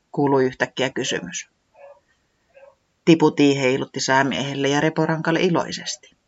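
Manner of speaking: plain reading voice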